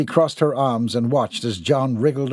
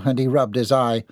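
ordinary delivery, nothing marked

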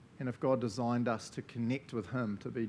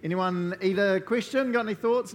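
raised, higher voice inviting answers; calling out to the room; 8 semitones higher, 8 dB louder than usual. Real, not acted. Leader calling out to the group, high and loud. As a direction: call it out loud to everyone.

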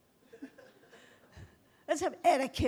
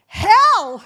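a sudden, much louder and higher cry of Hell; retold shout; 10 semitones higher, 22 dB louder than usual. Preacher recalling what she told the woman, a mock warning shout. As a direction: blurt out the word like a warning.

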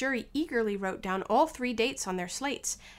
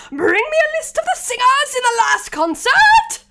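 very high, very loud royal screech; Queen's shrieked order; 15 dB louder than usual. Acted. Queen orders court officer.

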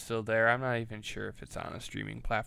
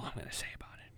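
fast whispered aside; muttering; 13 dB quieter, much faster than usual. Real, not acted. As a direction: mutter it under your breath. Muttered aside after rant.

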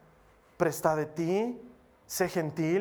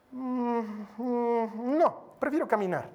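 a higher, curt "mm, mm, no" from the unfriendly coworker; mimicking the lady's refusal; 4 semitones higher, slower than usual. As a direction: turn down the ride coldly, in her voice. Imita la negativa de la señora. (la señora rechaza el aventón, voz aguda y seca)

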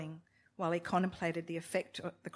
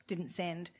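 ordinary delivery

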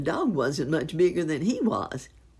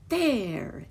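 higher-pitched "There!"; proud exclamation; 6 semitones higher, 3 dB louder than usual. A narrator's reading. Poodle ending its somersault act, proud exclamation. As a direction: exclaim it like a finished trick. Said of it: "exclaimed the poodle dog".

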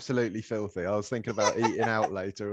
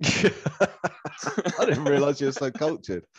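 teasing; 5 semitones higher, 3 dB louder, much slower than usual. Acted. Teasing mock posh remark.

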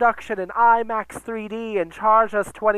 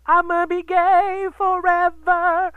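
mock flamboyant dancer; 8 semitones higher, 4 dB louder, slower than usual. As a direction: ham up a flamboyant dancer shouting from a car roof. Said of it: "homo jumping on a car".

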